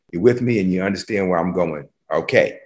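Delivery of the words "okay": normal talking voice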